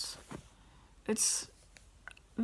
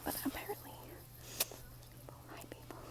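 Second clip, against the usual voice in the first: whispering; 4 semitones higher, 14 dB quieter, faster than usual. Real, not acted. Whispering near other people.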